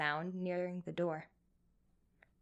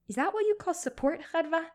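fast, higher, louder retort; annoyed snap; 9 semitones higher, 5 dB louder than usual. A narrator's reading. Rechi snapping at her friend on the phone, annoyed. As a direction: bite back at a backhanded compliment. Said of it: "Rechi had snapped".

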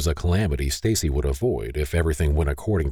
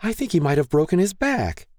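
much higher panicked voice; frantic mother; 13 semitones higher than usual. Acted. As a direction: panic rising in her voice.

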